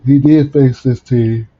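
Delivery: early-morning yawn breaking into speech; talking through a yawn. Real, not acted. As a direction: let a big yawn drag the words out. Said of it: "it's five in the morning".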